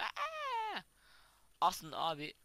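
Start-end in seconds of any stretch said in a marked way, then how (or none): 0.0-0.8 sudden very high scream; short shocked squeal; startled shriek. Real, not acted. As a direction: yelp as something jumps out. Mob suddenly appears.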